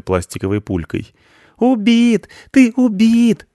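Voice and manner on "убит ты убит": put-on high, loud triumphant kid's yell; boy yelling. A narrator's reading. Boy to his playmate after a hit, yelling. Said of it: "завопил мальчик".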